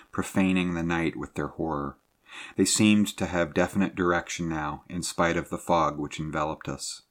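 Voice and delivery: plain reading voice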